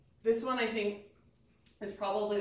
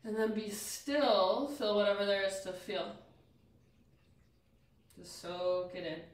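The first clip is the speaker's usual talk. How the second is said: guided meditation; 4 semitones lower, 4 dB quieter than usual. Acted. Leads stillness after breathwork.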